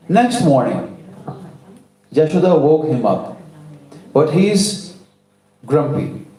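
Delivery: ordinary delivery, nothing marked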